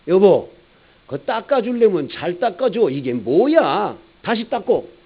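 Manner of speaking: mock irritated driver yelling from the window; impatient driver. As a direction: bark at the attendant to redo it. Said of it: "이 운전사가".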